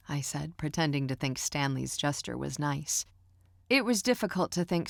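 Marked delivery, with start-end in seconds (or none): none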